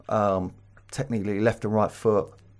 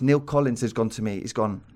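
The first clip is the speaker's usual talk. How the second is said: usual speaking voice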